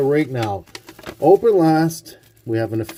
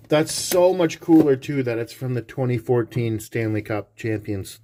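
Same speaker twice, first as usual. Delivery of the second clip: ordinary delivery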